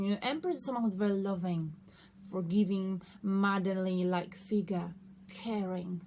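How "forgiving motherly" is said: ordinary talk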